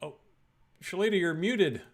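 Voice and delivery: usual speaking voice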